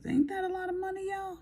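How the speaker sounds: put-on high, quick country accent. Vlogger asking viewers about her tax bill, playful country voice. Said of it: "That's my country voice".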